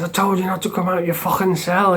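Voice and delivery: high-pitched mock angry telling-off; retold rage, hammed-up and high; imitating fuming cellmate. Cellmate scolds him for leaving the cell, mock fuming. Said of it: "He was fucking fuming".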